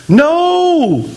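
sharp high No!; a high emphatic refusal. Real, not acted. Emphatic rebuttal.

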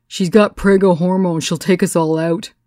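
a hint of a brash woman calling out. A narrator's reading. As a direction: tease loudly from the back. Woman from back, loud quip.

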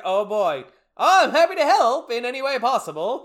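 mock-eager helper. Podcaster imitates the mayor offering to help, mock-eager. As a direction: play an over-keen mayor offering help.

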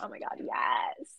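low unvoiced eager whisper; breathy delighted whisper. Imitating her reaction to fans shipping them, in a whisper. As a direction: whisper it like a guilty thrill. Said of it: "I was like".

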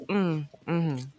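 low, noncommittal hums; feigned listening. Retold or imitated. Imitating co-host pretending to understand, noncommittal hums. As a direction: hum along without meaning to reply. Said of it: "You ain't prepping to respond".

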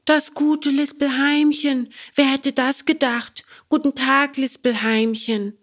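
polite Bengele. Acted. Bengele greets the cricket politely, boyish voice. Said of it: "sagte Bengele und zog anständig die Mütze ab".

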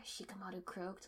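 plain reading voice

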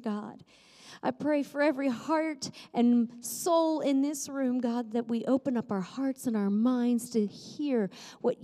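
earnest spoken prayer. Acted. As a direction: pray it with the whole room listening. Opening prayer before the sermon.

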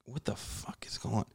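hushed, barely voiced "what the fuck"; stunned hush. As the back door lock breaks, hushed shock. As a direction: drop to a shocked near-whisper.

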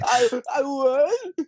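high, slow, choked-up "I won"; overwhelmed tearful joy. Retold or imitated. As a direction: stretch it out, barely believing it. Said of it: "And I was like".

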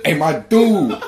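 high-pitched, hyped-up hello with laughter; high-energy greeting. Retold or imitated. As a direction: imitate a wildly enthusiastic mailman. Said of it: "came with a hundred and fifty percent energy".

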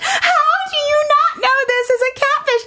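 high, slow, laughing squeal of disbelief. Real, not acted. To viewers about the catfished guy, squealing in disbelief. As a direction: stretch every word out in shocked laughter.

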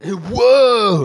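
loud, high, drawn-out "whoa"; surfer-dude whoa. Acted. Podcaster mimics famous actor's catchphrase, loud surfer-dude whoa. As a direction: belt it out as a mock surfer exclamation. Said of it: "Yeah, like that".

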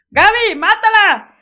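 big brother urging his sister to stomp it; kid yelling an order. Retold or imitated. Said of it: "y el hermano grande".

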